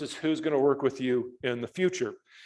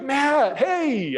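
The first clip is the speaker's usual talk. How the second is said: higher, louder, bubbly hello; cheery greeting; 11 semitones higher, 6 dB louder than usual. Retold or imitated. Client greeting Matt as a dear friend, bubbly. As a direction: greet him like an old friend. Woman greets him warmly.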